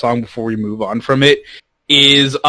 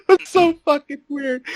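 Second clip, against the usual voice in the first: high-pitched words through real laughter; laughing. Real, not acted. Cracking up at the Chupacabra speech, laughing. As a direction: crack up while saying it.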